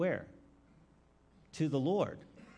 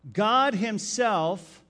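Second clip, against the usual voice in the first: slow and loud, higher, "himself" hammered; stress on himself; 7 semitones higher, 8 dB louder, much slower than usual. Shown as a demonstration. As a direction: pound out "God himself" slowly. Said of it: "underline the word himself".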